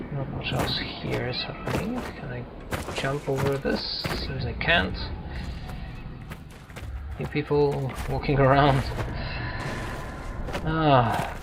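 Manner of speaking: normal talking voice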